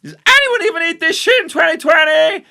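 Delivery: high, screaming meltdown; mock hysterical breakdown. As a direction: shriek it like you're falling apart, hammed up. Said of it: "And she has a breakdown".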